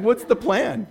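ordinary talk, nothing marked

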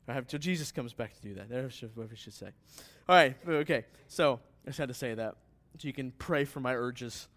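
ordinary talk

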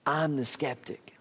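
soft, slow admission; quiet confession. Real, not acted. Pastor confessing to the church, hushed. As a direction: own it humbly.

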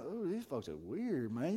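soft, slow, drawn-out mock unease; imagined disapproving aside. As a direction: mutter it slowly as an aside. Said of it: "I can see Elijah going".

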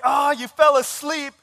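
high, loud, slow accusation; upset child. Retold or imitated. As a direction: imitate an outraged little boy. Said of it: "he was so upset at me".

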